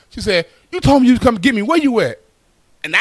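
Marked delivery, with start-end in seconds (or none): 0.7-2.2 higher-pitched mock complaint; annoyed wife. Stranded wife demanding husband come get her, mock annoyed. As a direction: retell an irked phone demand.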